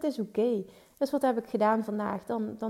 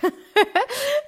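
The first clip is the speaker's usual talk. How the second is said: nerveus lachje; 7 dB louder than usual. Real, not acted. Spannend moment, zenuwachtig gelach.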